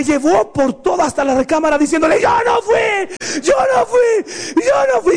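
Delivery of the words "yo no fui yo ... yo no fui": high, stretched, frantic mock wail; screamed denial. Child dragged off by mom, high wailing denials. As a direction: imitate a kid shrieking innocence over and over.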